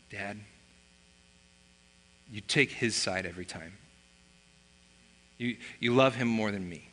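low, subdued voice of a hurt son; softly wounded accusation, lightly acted; retold sulking. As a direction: imitate a pouting kid's quiet complaint. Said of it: "he's kinda sulking".

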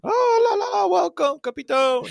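very high, loud fawning greeting; gushing falsetto welcome; french chef. Retold or imitated. Mocking staff fawning over captain.

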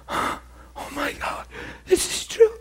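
as if the truth just hit her; whispered gasp rising into a high cry; breathless realization. Retold or imitated. Woman realizing she is loved.

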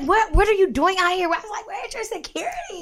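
high, laughing disbelief; amazed fan. Retold or imitated. Asking Andre where his security is, high and giddy.

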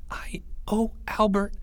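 high unvoiced whisper of a scared kid; high boyish whisper. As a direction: breathe it out like a frightened child. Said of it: "he whispered".

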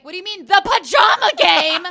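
mock-offended disbelief, shouted out; incredulous outrage. Incredulous retort about a role.

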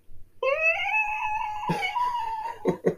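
high squealing laugh. Real, not acted.

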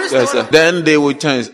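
ordinary delivery

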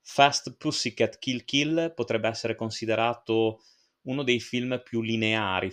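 ordinary talk

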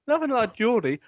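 ordinary talk, nothing marked

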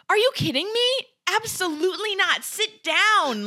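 high-pitched, exasperated telling-off; mock-outraged refusal; indignant scolding. Acts Hermione scolding approaching classmates.

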